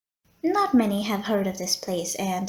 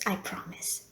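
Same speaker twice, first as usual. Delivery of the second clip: "I promise" whispered, much softer; whispered repeat; 2 semitones lower, 10 dB quieter than usual. Acted. Receptionist promising no more jokes, whispered. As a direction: breathe "I promise" again under the breath. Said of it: "The last joke, I promise".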